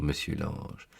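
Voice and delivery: ordinary delivery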